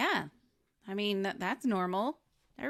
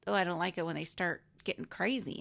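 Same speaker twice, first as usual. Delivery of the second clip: usual speaking voice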